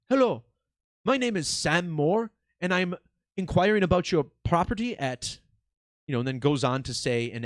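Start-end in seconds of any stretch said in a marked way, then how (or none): 0.1-5.4 mock the caller's non-native English accent, pitched up; a light take on a foreign-accented telemarketer. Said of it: "sounded like he had an accent".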